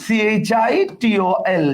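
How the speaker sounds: letter by letter, very slow; slow, deliberate spelling for students. Shown as a demonstration. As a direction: spell each letter out for the class. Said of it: "তাহলে আমরা কি করব?".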